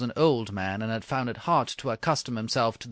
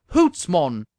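broad Scots exclamation; Scottish accent; 2 semitones higher, 8 dB louder than usual. Acted. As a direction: bark it in a Scots brogue. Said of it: "said the charioteer".

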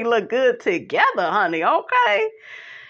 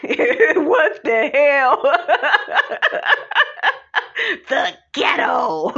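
laughing; 4 semitones higher, slower than usual. Real, not acted. Laughing at reality show moment.

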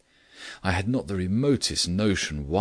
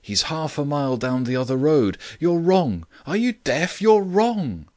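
high, loud protest, building in pitch; frantic howl; 8 semitones higher, 5 dB louder than usual. A narrator's reading. Chasing friend who runs the wrong way, building howl. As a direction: howl with mounting exasperation at someone who won't listen. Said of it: "I howled".

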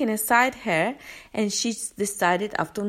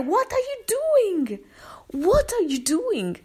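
retold scream; 11 semitones higher, 3 dB louder, slower than usual. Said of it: "I scream at her".

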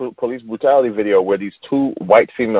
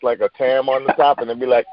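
ordinary delivery

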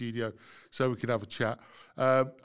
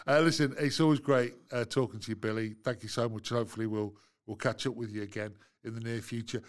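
ordinary talk

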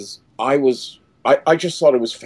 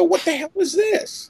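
much higher, incredulous cry; shocked outrage. Retold or imitated. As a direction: react like a kid betrayed by the movie. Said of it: "and I go".

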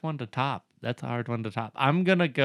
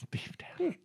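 breathy laughing whisper; 12 dB quieter than usual. Real, not acted. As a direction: echo it in a hushed whisper. Whispered echo of ingredient.

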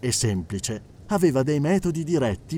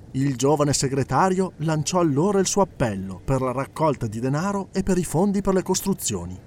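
usual speaking voice

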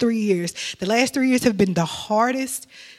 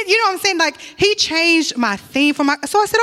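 normal talking voice

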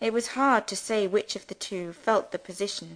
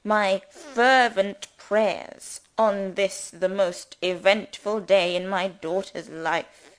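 pompous rector; 4 dB louder than usual. Acted. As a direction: preach at them like a self-important parson. Pompous rector addresses family.

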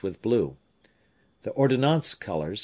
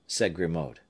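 ordinary delivery, nothing marked

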